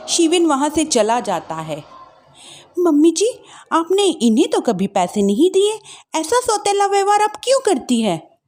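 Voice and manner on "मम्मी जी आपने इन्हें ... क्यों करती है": higher, accusing daughter-in-law; young wife voice. Acted. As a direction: challenge the mother-in-law. Wife questions mother-in-law.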